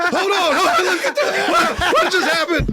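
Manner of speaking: high, protesting through laughter; mock alarmed bewilderment. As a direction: try to stop the crowd in a high voice. Himself baffled by the mob.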